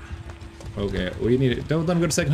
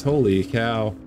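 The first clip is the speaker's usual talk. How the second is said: ordinary talk, nothing marked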